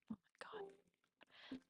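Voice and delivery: slow, breathless whisper of dismay. Real, not acted. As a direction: whisper it to yourself in disbelief. Fumbles the game exit.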